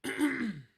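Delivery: hacking cough after yelling; rough choking cough; coughing fit. Real, not acted. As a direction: cough hard, choking on saliva. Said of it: "I'm dying over here".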